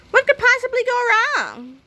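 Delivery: put-on a boy brushing off all danger; cocky kid. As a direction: toss it off like nothing could fail. George cocky rhetorical question.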